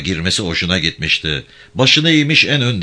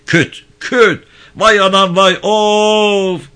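much higher, louder mock cries of pain; fake wailing; 13 semitones higher, 7 dB louder, much slower than usual. Prisoner faking cries so the guards think he's beaten. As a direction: pretend to be beaten, wail it out. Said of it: "Bağır haydi".

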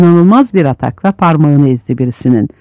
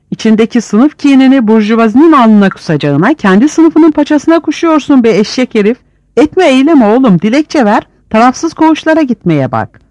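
angry scolding; 7 semitones higher than usual. Acted. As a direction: berate him hard. İbo scolds cowering Hicri.

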